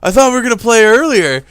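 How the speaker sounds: high, loud mock whine; mocking whiny imitation. Teasing his co-host by quoting him, high mock whine. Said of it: "You're just like".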